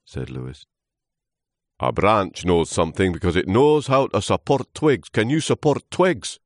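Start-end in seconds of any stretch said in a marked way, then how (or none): 1.8-6.4 loud, scornful character voice; lecturing old trunk. Acted. Old trunk testing Lewis, loud lecturing. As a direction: quiz him loudly and pompously.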